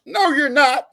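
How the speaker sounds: high, loud East Tennessee sergeant voice; Claude Higgs. Retold or imitated. Sergeant refusing a soldier's Ranger plan, high, loud mimicked drawl. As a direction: imitate a hollering Southern sergeant shooting it down. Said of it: "And his voice".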